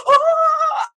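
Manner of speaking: sudden high wailing scream of breakdown; a sharp cry alone in the car; anguished cry. Retold or imitated. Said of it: "ugly cries".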